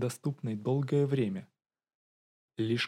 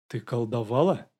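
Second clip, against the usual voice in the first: indignant; 5 dB louder than usual. A narrator's reading. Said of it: "Голос графини был возмущённым".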